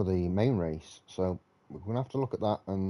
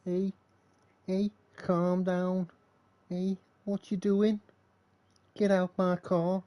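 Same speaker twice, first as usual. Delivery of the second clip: hammed-up Liverpool accent, high and slow; a deliberately bad Scouse impression, pitched up and drawn out; 11 semitones higher, slower than usual. Streamer mocking the fake Scouser in the Aston Martin, bad Scouse accent. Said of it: "That's my really bad Scouse accent".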